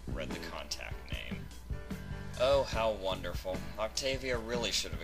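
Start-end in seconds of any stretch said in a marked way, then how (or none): none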